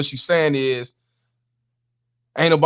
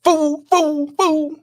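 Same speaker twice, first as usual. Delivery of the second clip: much higher put-on tough-guy voice; Mister T voice; 13 semitones higher, much slower than usual. Streamer mocks the sister as sounding like Mister T, much higher voice. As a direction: bark the word like a TV tough guy, pitched way up. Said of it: "she sound like Mister T".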